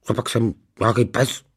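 indignant growl of a dog-father. A narrator's reading. Dog-father protesting to his family, growling. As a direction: growl the question like an offended dog. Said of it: "zavrčel pan Majer".

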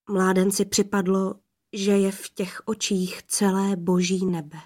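ordinary delivery

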